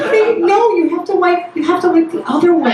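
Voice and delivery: high, urgent correcting; instructing mom. Retold or imitated. Mother teaching daughter to wipe, high urgent correction. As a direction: correct her firmly but kindly. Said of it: "I'm like".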